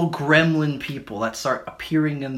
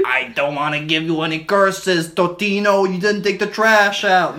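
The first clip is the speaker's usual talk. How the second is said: scolding grandma; 6 semitones higher, 6 dB louder than usual. Acted.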